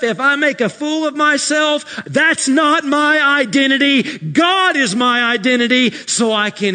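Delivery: loud, high preaching climax; full-force preaching. Acted. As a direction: thunder it to the congregation.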